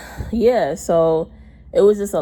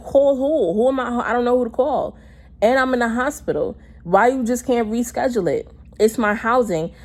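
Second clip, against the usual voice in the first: higher, exasperated retold protest; arguing back; 5 semitones higher than usual. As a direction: plead your case in a retold argument. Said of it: "arguing with her".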